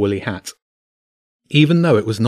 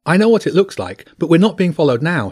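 high, louder, urgent plea; pleading; 7 semitones higher, 5 dB louder than usual. A narrator's reading. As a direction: insist desperately on being believed. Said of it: "she pleaded".

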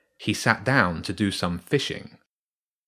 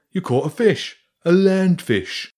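much higher, slow, louder wonder; amazed rat; 9 semitones higher, 6 dB louder than usual. Acted. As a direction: savour the joke about a land fish. Rat marvels at the boy.

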